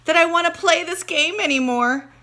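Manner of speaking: panting, high and loud; boy escaping a lion, panting; out of breath. Acted. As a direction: gasp it out after running. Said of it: "between gasps of air".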